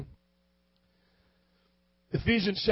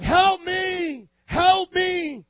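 high desperate shout; retold cry for help; 9 semitones higher, 4 dB louder, much slower than usual. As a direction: cry out like a homeless man begging on the street. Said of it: "this guy cried out so loud".

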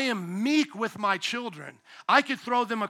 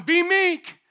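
higher, louder firm command; Jesus commanding; 9 semitones higher, 8 dB louder than usual. Retold or imitated. Jesus telling his disciples to be meek, raised and firm. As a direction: give the order to the disciples.

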